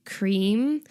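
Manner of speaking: "Cream?" with a rising, questioning end. Retold or imitated. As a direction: say "Cream?" as a tentative guess, lifting the end. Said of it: "he out loud questions".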